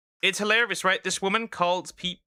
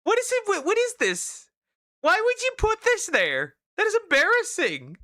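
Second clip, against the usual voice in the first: incredulous; 14 semitones higher than usual. Real, not acted. Incredulous outburst at voice memo.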